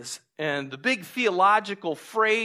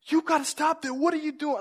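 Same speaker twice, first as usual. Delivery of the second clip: high-pitched panicked protest, acted out; freaking out; 11 semitones higher than usual. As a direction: re-enact a terrified passenger pleading. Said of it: "I was freaking out at this guy".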